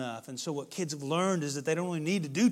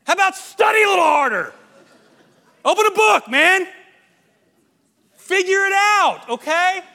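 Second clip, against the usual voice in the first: much higher and louder, half-laughing tough love; exasperated parent shout; 17 dB louder than usual. Retold or imitated. Parent yelling at a teen to study harder, mock shout. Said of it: "I didn't yell this loud".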